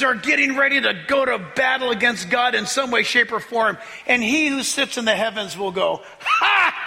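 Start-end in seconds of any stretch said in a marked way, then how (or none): 6.2-6.8 high mocking "Ha!" of God; one big scornful laugh; God's triumphant ha; booming laugh.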